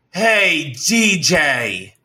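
imitated angry holler; retold screaming. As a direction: call him out, half-screaming. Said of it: "like, screaming in front of everyone".

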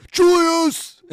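high, loud, drawn-out bellow of a name; mimicked sideline yell; yelling coach. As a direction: imitate a coach hollering down the bench. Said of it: "turns around and yells".

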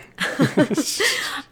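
high giggle after a burp; embarrassed laugh. Real, not acted. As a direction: laugh it off, a bit sheepish.

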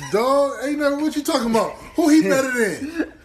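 loud, high disbelief; incredulous protest. Real, not acted. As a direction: push back, amazed and laughing. Defending top five, incredulous.

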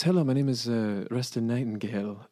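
lightly put-on gentleman at the door; a hint of a formal caller introducing himself; polite visitor. Yacht's envoy introduces himself at the door, lightly voiced polite visitor.

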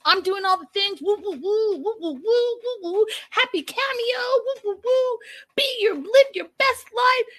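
high hyped-up cameo voice; super animated. Retold or imitated. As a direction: overdo the peppy cameo host. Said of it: "See how I'm, like, super animated?".

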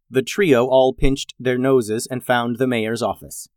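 plain reading voice

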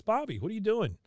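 high, startled woman's voice; Connie scolding. Retold or imitated. Connie catching Bobby at the deli, high shocked voice. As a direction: pitch way up as a shocked girlfriend.

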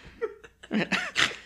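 shrill burst of laughter; high squealing laugh. Real, not acted. Laughing at own caca joke.

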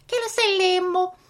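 mock Italian gibberish; hammy pretend Italian accent; fake Italian. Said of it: "Stop it, [name]".

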